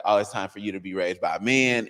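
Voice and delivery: ordinary talk, nothing marked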